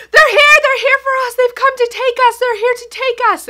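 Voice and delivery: put-on high, loud frantic child's cries; panicked child. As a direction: cry out like a terrified little boy.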